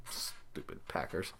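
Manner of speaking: breathy whispered aside under the breath; whispered grumble. Real, not acted. After picking the team he dislikes, a whispered grumble. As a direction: mutter it to yourself, barely voiced.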